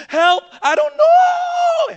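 high, loud hammed-up wail; acted helpless shout; crazed panic. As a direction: stretch the last word into a mock panicked cry. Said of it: "we get all crazed".

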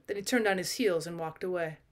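ordinary delivery, nothing marked

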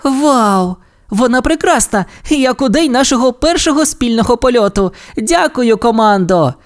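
put-on high-pitched, thrilled girl's voice; delighted exclamation. A narrator's reading. Ace thanks the team for her repaired plane, thrilled exclamation. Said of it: "вигукнула Ейс".

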